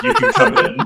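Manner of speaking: squeaky high receptionist voice; laughing receptionist voice; amused receptionist. Retold or imitated. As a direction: mimic a startled receptionist.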